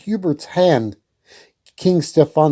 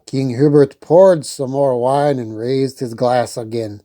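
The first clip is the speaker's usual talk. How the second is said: plain reading voice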